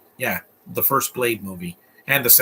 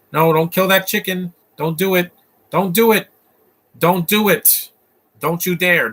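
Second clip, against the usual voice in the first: pleading; 5 semitones higher, 4 dB louder than usual. Real, not acted. Pleading with screen.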